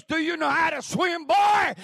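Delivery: put-on loud, high drill instructor yell, booming on boy; drill sergeant bark. Drill instructor yelling at a recruit by the pool.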